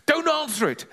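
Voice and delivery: put-on high, mildly panicked boy; urgent high-pitched plea; alarmed boy. Boy begs granny at the doorbell, high alarm.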